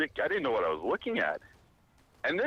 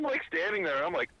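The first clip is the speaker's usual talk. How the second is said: ordinary talk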